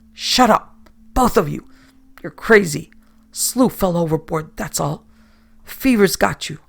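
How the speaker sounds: loud, harsh commanding shout; angry captain. A narrator's reading. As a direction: bark them into silence. Captain angrily silences crew.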